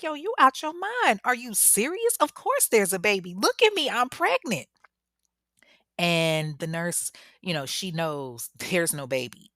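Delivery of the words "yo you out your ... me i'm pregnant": high, loud incredulous protest; indignant disbelief. Retold or imitated. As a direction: act out an outraged challenge. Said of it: "Tasha's not trying to hear that".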